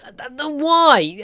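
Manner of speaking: slow, high, loud cry of disbelief; exasperated stammer. Real, not acted. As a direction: sputter in disbelief at the absurdity. Ranting about stereotypes in film.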